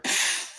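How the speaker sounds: voiceless whooshing blast; imitated flamethrower. Retelling the flamethrower firing, imitated whoosh. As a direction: blow out a burst of fire noise.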